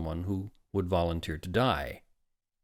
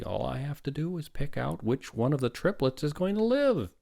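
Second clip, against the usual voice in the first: higher, lilting sarcastic breeziness; mock carefree; 6 semitones higher than usual. A narrator's reading. Father explains the grim choice, mock carefree. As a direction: make it sound so simple, climbing at the ends. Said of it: "to symbolize carefree simplicity".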